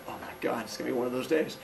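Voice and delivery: low, very soft grumble; weary officer aside. Retold or imitated. Officer facing one of those days, low weary aside. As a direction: drop to a resigned undertone.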